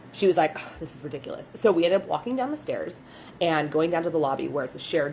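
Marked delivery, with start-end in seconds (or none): 0.5-1.5 mock huffy grumble, much softer; imitated exasperation. Client complaining about moving to lobby, imitated exasperation. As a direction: grumble it under your breath.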